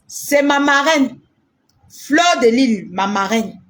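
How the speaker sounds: normal talking voice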